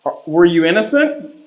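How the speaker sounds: loud question to a spirit; talking to a ghost. Real, not acted. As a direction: call out to the unseen. Asking the spirit loudly.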